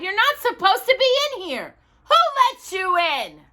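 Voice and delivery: high, loud telling-off; scolding. A narrator's reading. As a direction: scold the bear sharply. Stagehand scolding the intruder.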